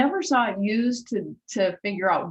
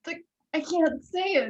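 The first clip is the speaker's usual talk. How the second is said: nervous, high refusal; 9 semitones higher than usual. Retold or imitated. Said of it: "really nervous to tell her".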